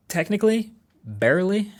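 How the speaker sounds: high, dry, doubtful; wry, grudging. Real, not acted. Wry verdict on OnePlus success.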